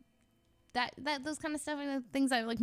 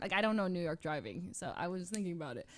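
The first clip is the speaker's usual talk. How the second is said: usual speaking voice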